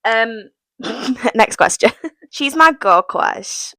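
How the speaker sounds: usual speaking voice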